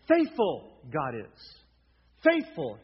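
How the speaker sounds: loud, drawn-out stress on "faithful"; emphatic stress. Shown as a demonstration. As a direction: punch "faithful" hard and stretch it out. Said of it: "he's emphatic here".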